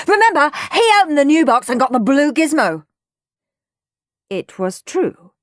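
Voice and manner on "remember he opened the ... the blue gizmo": fast, loud, high rant; screeching. A narrator's reading. Henry reminding Mum about the last box, screeching. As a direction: rattle off the complaint at full volume. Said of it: "screeched Henry".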